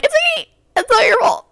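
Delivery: tiny, very high weepy voice; mock-sob through the reassurance; retold crying. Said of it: "I'm crying".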